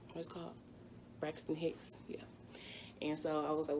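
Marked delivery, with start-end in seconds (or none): 0.1-2.2 whisper. Real, not acted. Remembering the term, aside.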